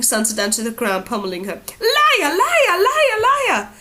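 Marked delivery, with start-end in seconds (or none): 1.8-3.7 much higher, louder repeated scream; a girl screaming at her sister while hitting her; screamed insults. A narrator's reading. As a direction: scream it with every blow. Ira pummeling her sister.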